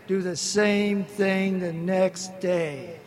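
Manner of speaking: slow, deliberate punchline. Retold or imitated. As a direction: lay it out slowly so it lands. Sponsor's punchline, drawn out.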